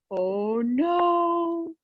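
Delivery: a touch of storybook alarm; hammed-up little oh no; mock dismay. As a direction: pretend worry for the kids. Letters fall from the tree.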